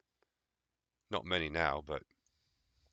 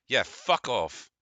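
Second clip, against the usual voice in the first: sneering dismissal; 5 semitones higher, 3 dB louder, much slower than usual. Acted. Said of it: "leans over his shoulder and is like".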